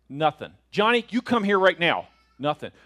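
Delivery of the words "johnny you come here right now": louder, insistent mock scolding; exasperated parent. Parent ordering Johnny over, mock stern. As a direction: demand it from an ignoring child. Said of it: "the parents that are like".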